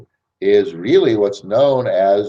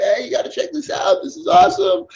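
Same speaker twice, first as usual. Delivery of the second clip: much higher, a hammed-up gushing pitch; hyped-up enthusiasm. Joe pitching the next guy, hammed-up hype. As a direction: oversell it like a pumped salesman. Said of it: "with all this excitement, enthusiasm, going".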